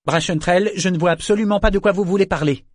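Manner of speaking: sharp, high-pitched protest; brisk denial. A narrator's reading. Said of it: "déclara-t-elle vivement".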